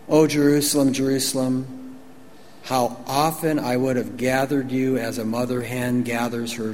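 low, measured grief in a retold plea; understated, aching appeal; sorrowful lament. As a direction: lightly voice a weeping Jesus. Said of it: "Jesus is weeping".

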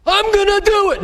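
high, loud boast of own strength; proud self-reliance. Retold or imitated. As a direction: puff up as if you can do it alone. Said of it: "Not by".

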